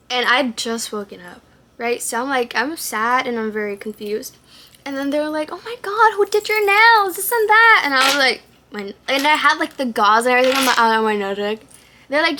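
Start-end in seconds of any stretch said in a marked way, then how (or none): 5.5-7.8 high, loud imitated gushing; gushing staff voice. Staff admiring patient's nails, high gushing voice.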